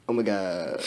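low, soft mumble; mumbled to herself. A narrator's reading. Ebony muttering to herself, mumbled. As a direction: mumble it under your breath. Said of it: "mumbled to herself".

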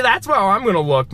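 usual speaking voice

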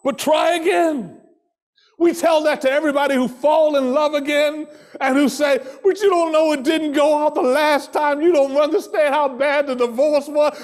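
rising, shouting sermon voice; preaching. Acted. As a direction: preach it out with full force. Preaching at full force.

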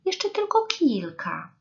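high begging little voice; wheedling storybook rabbit; pleading rabbit. A narrator's reading. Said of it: "poprosił królik".

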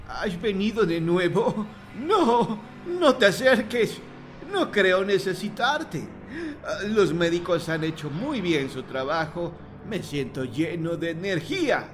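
put-on high, tense old man voice pushing fear away; scared old man, forced bravado; frightened old man. A narrator's reading. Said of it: "Parece tener miedo, mucho miedo".

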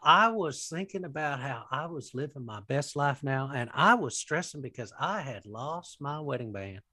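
put-on earnest, swooping televangelist storytelling in a Southern accent; Joel Osteen. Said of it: "That's a really good accent".

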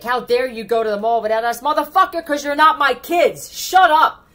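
loud, high furious outburst; angry rant. Acted. Reader yelling at entitled people, furious and loud. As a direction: yell it at them, fed up.

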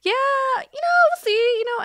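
very high, vague brush-off; noncommittal high voice. Retold or imitated. Own noncommittal reply, very high.

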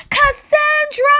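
very high, drawn-out yell of a name; both yelled. Acted. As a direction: holler her name in shock. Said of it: "they both yelled".

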